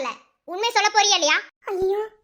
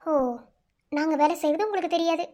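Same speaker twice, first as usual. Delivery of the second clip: ordinary delivery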